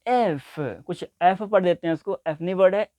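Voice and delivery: usual speaking voice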